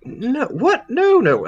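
high-pitched, incredulous denial; startled, dismissive in-character protest; surprised protest. Acted. Character protests an apology of blame.

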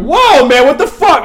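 very high, loud mock alarm; startled pacifying. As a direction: play trying to calm a raging attacker. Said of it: "try to, like, pacify you down".

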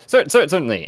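high, loud stuttered agreement; nervous stammer. Acted. Cricket agreeing to boss's order, nervous stammer.

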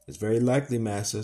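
usual speaking voice

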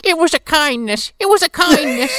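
very high, insistent granny; old lady voice. Retold or imitated. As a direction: repeat it shrieking like an old woman. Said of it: "She's like".

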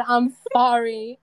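loud, slow "sorry" with th for s; lisped sorry. Retold or imitated. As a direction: hit the th that starts "sorry". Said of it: "T H instead of an S".

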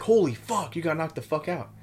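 shocked squadmates shouting at the knocked-out private; stunned buddies. Retold or imitated. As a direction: mimic the guys gasping at the knockout. Marines react to knockout.

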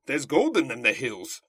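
loud, hollering gold-rush prospector; old prospector. Retold or imitated. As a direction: whoop it like a grizzled miner. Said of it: "like an old prospector".

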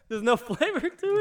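high, loud laughing question. Real, not acted. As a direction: laugh the words out. Laughing at friend's reaction.

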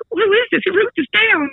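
very high-pitched mock demand; squeaky take-off of a woman demanding answers; imitated flustered manager. Manager finds a prank note in her bag, mock flustered demand.

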